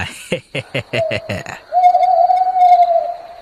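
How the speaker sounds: evil cackle. Acted. Dacoit leader gloats before robbery.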